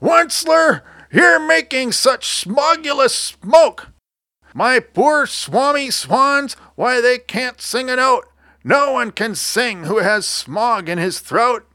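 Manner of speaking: high-pitched, croaky creature complaint; indignant little creature with smog in the throat; croaking cry. A narrator's reading. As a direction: scold in a high cartoon croak, drawn out. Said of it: "he cried with a crufulous croak".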